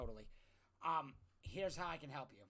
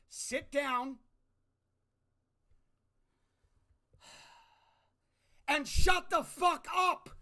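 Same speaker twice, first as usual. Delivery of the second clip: shouting much higher and louder; full-throated furious yell; 9 semitones higher, 11 dB louder, slower than usual. Real, not acted. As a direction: bellow it at Congress. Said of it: "it's about to get a little loud".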